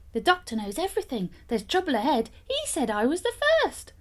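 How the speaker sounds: a frightened thief blurting to his mates; scared servant. Acted. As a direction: panic rising, last line jumps high. Thief servant panics to accomplices.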